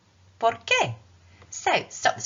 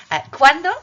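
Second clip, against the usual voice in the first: loud cue; 8 semitones higher, 13 dB louder, faster than usual. Real, not acted. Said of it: "teacher calls out".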